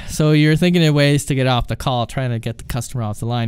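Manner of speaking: ordinary talk, nothing marked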